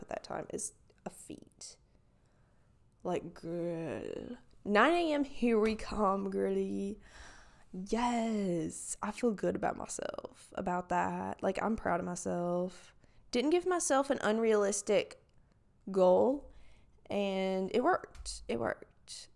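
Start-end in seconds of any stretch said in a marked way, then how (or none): 0.0-4.0 hushed, confiding whisper; whispered aside. Real, not acted. 18.5-19.3 softly whispered repeat; whispered echo. Real, not acted. Echoing her own words.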